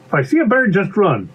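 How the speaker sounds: high-pitched obedient character voice; little pea repeating. Acted. Pea recites bee's rule back, high and dutiful.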